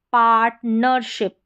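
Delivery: पार्टनरशिप slowly split as "part, ner, ship"; syllable-by-syllable demo. Shown as a demonstration. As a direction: break पार्टनरशिप into three parts.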